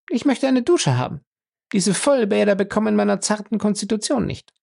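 high, dainty female character voice; cool, high-voiced lady making demands; haughty captive woman. A narrator's reading. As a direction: state the demand loftily, pitched high.

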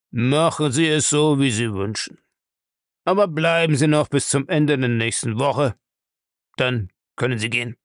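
put-on a gruff old man's voice, evasive; gruff old castle owner. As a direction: play the old castle lord brushing off the question. Bellamy grants, asks delay.

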